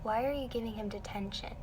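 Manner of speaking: softly imitated puzzled pupil; small, polite questioning voice; timid question. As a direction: ask the teacher carefully and softly. Retold timid question to the teacher.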